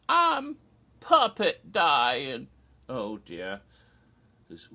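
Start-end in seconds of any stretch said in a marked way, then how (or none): 0.1-2.5 slow, stretched mock death wail; high, melodramatic Southern dying voice; dying Smaug. Puppet dragon answering a sceptic, hammed-up dying wail. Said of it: "and the poor dragon is".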